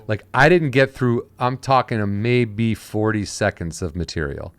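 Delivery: ordinary talk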